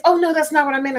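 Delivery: mocking the friend's defensive denial. Friend denies it in a quarrel.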